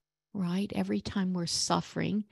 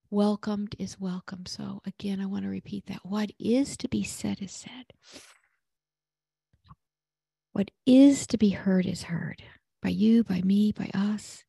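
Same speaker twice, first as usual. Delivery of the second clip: prayerful blessing. Acted. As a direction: close the talk with a hushed blessing.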